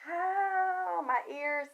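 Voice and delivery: high, pained complaint; real high "ow" of discomfort; real ear discomfort. Real, not acted. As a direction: react to sudden ear pain. Sudden ringing in the ears.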